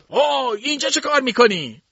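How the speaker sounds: high, loud, startled challenge; old man shouting. A narrator's reading. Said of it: "داد زد". (فریاد بلند پیرمرد)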